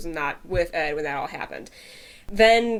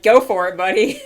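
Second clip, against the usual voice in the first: laughing; 9 semitones higher, 8 dB louder than usual. Retold or imitated. Ling agreeing, laughing.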